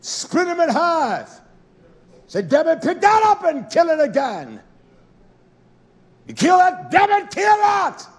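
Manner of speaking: high, full-force shouting; thundering shouted command. Acted. Preacher voicing Yah's order to kill, roaring. Acting out God's repeated orders.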